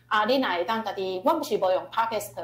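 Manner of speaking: ordinary talk, nothing marked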